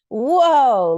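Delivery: loud, high yelp of surprise; startled whoa. A narrator's reading. Little girl wobbling on a stool, startled whoa. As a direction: cry out as the stool tips. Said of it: "Lovey cried as she wobbled".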